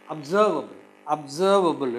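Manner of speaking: "observable" with a loud SERV; "observable", strong SERV and falling end; stress on second syllable. Shown as a demonstration. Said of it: "The second syllable is stressed".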